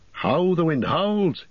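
high, drawn-out cry of alarm. A narrator's reading. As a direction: call it out high and slow, urgently. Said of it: "he cried".

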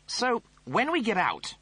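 high, snooty officer voice; pompous Rimmer voice. Acted. Rimmer reasons.

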